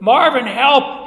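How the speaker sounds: loud, high call across the site; sudden shouted cry for help. Retold or imitated. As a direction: yell for your partner to come.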